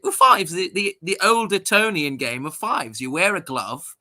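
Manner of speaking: put-on posh English friend explains the old Etonian game. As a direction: patiently explain Eton fives in a light posh English voice. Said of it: "And he's like".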